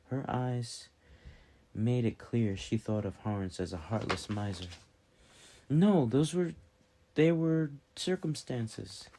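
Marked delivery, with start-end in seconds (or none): 5.7-9.1 higher, faltering self-defence; flustered protest. A narrator's reading. Merchant defending himself against a charge of heartlessness, flustered. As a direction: protest in a higher voice, stumbling for an excuse.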